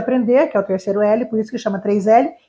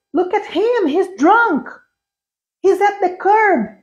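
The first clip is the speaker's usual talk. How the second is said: actor line; 8 semitones higher, much slower than usual. Shown as a demonstration. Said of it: "quando ela visse".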